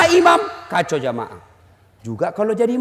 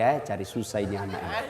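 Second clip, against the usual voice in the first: low, quiet muttered aside; quick, low private grumble; inner thought; 11 dB quieter, faster than usual. Retold or imitated. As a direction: think it aloud under your breath. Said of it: "Ku bilang dalam hati".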